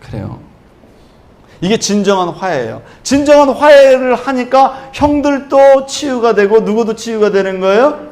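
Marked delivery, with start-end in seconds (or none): 1.6-8.1 loud, high, impassioned preaching; full-force preaching. Acted. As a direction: preach it out, building with fervour. Fervent call on reconciliation. (힘차게 외치는 설교)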